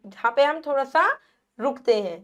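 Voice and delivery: usual speaking voice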